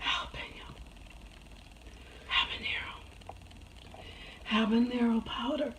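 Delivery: rasping voiceless whisper from pepper burn; barely any voice left after spicy food; lost voice. Real, not acted. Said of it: "I really lost my voice".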